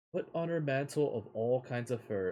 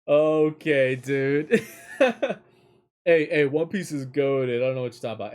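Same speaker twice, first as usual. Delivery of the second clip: much louder, laughing mock outrage; loud laughing protest; 3 semitones higher, 12 dB louder than usual. Real, not acted. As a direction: defend One Piece through laughter. Protests to chat about One Piece.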